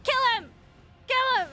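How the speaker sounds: high, loud chant of Kill him; yelling at the TV. Retold or imitated. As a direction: cheer for the kill, shouting. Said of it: "yelling at my T V".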